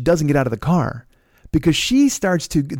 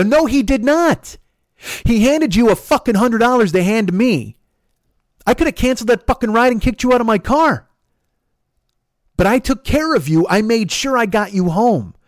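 high, heated argumentative tirade; angry outburst; 8 semitones higher, 4 dB louder than usual. Retold or imitated. Driver accusing passenger of keeping the money, angry outburst. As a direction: argue back hard with raised pitch.

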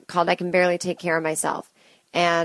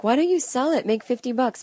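higher-pitched mock of other people; nosy advice-givers; 7 semitones higher than usual. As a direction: mimic people pushing a helpful suggestion, pitched up. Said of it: "And people are like".

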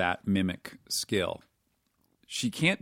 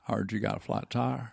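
Matthew McConaughey impression; 3 dB quieter than usual. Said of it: "Did you know you could do this impression?".

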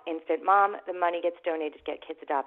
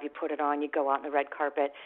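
normal talking voice

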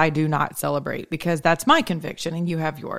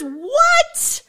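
excited squeal. Real, not acted.

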